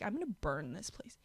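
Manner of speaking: unvoiced mock-threat whisper; whispered fake menace. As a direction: hiss it under your breath like a joke threat. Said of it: "I was literally like".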